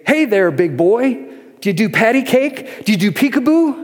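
high, hammed-up old man playing with a baby; mock old man cooing at a little boy; overly loud old man. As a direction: coo at the toddler like a stranger at the next table. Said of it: "speak in an overly loud voice".

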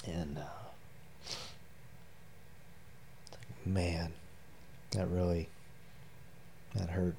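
low, hushed, halting with long pauses; choked up. Real, not acted. Father moved by son's confession, choked up and subdued. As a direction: hold back real emotion, softly. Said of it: "without, uh, choking up".

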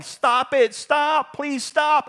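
put-on high, loud pleading of a little girl; yelling. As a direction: imitate a frantic child begging for it to end. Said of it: "she was yelling".

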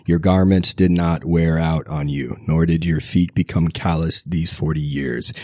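usual speaking voice

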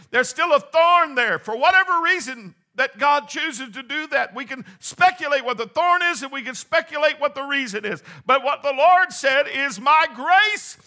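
high, loud climactic preaching; full-force preaching. Real, not acted. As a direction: build the congregation to a shouting climax.

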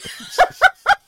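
cracking up at the punchline; loud high laugh; high burst of laughter. Real, not acted. Laughing at the beard joke.

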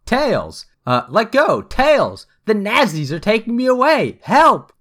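high-pitched acted cries for help; mock scream. A narrator's reading. Sonic grabbed by soldiers, mock screaming for Tails. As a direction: call out high, building to the last word. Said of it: "screamed Sonic like a wild ass".

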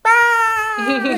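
high, loud shriek; cold-water scream. Retold or imitated. Girl running into frozen lake.

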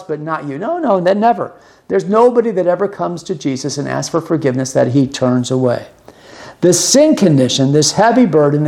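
ordinary talk

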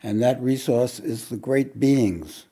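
preaching. Acted. Preaching with rising force.

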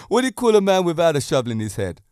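ordinary talk, nothing marked

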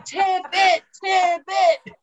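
high chanting crowd shout; yelling fans. Retold or imitated. Storyteller imitates fans by the porta potty, yelled chant. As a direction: mimic rowdy fans egging each other on. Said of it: "all the Steelers fans start yelling".

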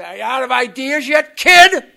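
put-on loud, rising jab at the kid; towering sponsor challenging the newcomer, loud; retold loud challenge. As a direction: bark it down at him like a big sponsor.

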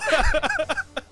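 squealing high laugh; giggling fit. Real, not acted. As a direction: burst out laughing. Laughing at googling.

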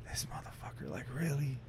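whispered, disbelieving; whispered disbelief at the rude dealer; whispered under breath. Retold or imitated. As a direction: breathe it out so only you hear. Muttered disbelief about the dealer.

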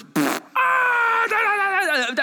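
imitating a kid razzing everyone up; mock kid noises. Camper kid making silly noises to restart the fun. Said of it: "one of 'em goes".

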